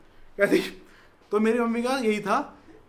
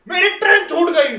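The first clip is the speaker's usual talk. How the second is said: loud, high furious shout; angry yell; 8 semitones higher, 10 dB louder than usual. Retold or imitated. Passenger yelling at the police who held him back, angry shout. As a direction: yell it in their faces. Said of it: "मैं ऐसे चिल्ला के उन पे बोलता हूँ".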